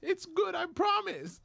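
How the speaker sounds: slow, very high pleading promise; mock begging. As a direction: imitate a desperate beggar in falsetto. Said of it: "sound like he was begging".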